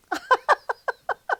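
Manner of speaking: a high burst of laughter; sudden, high, delighted laugh; real laugh. Real, not acted. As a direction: laugh out loud at her own boldness. Relief after bold announcement.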